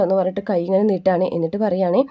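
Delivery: ordinary talk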